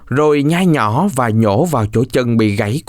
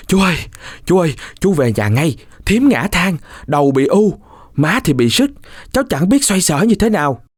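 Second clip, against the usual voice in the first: put-on higher, urgent boy's cry to his uncle; urgent calling out; 5 semitones higher than usual. Cuội calls his uncle home from the field, urgent call. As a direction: shout the false alarm across the field. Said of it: "Cuội đã gọi giật giọng".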